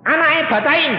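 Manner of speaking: high, loud quick reply; brisk retold answer. Pastor retelling his reply to the mother who can't read, brisk and loud. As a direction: answer her sharply.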